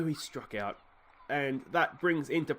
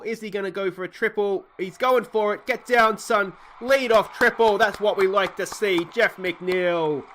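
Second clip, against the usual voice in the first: much higher and louder, thrilled play-by-play yelling; excited shouting; 6 semitones higher, 7 dB louder than usual. Real, not acted. Gamer cheering his batter's triple, excited and loud. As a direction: roar it like your team just ripped a triple.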